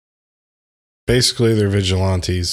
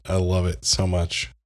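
usual speaking voice

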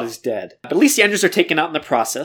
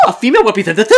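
higher, louder, laughing; laughing mock shock; 5 semitones higher, 6 dB louder, much faster than usual. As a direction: act scandalized, giggling.

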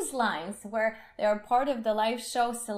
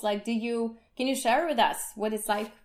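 normal talking voice

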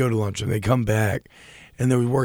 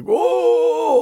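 a big, much higher whoa of wonder, a bit louder; mock amazed whoa; 6 dB louder than usual. Podcast host recalling being fooled by the finale, mock amazed. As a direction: gasp out a hammed-up whoa. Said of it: "But I was still like".

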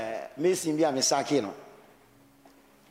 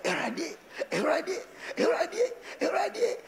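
Erade climbing higher each time into a whining wail; hammed-up wailing prayer; mock desperate pleading; 10 semitones higher, slower than usual. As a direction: cry out to the Lord more desperately with every repeat.

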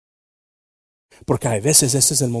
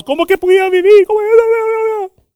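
much higher, panicked mock cry; frightened disciples; 12 dB louder than usual. Disciples frightened in the boat, high panicked cry. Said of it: "cuando están todos espantados".

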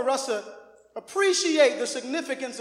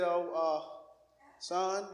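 low, much softer hesitant start; soft-spoken dad; 8 semitones lower, 12 dB quieter, much slower than usual. Retold or imitated. Father answering his upset son, soft and low. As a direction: ease in gently like a quiet father. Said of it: "He's soft".

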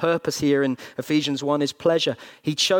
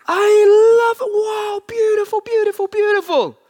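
exultant fatherly shout; 9 dB louder than usual. Retold or imitated. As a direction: yell it out, overcome with delight. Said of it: "He looks on you and says".

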